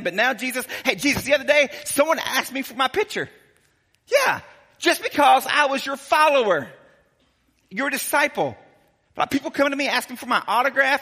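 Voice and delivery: boastful disciple. Acted. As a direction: brag like a giddy star-struck follower.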